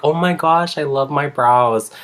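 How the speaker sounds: high, drawn-out mock gushing; put-on delight, slowing at the end; delighted client. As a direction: imitate a client gushing over the result. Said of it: "they like it".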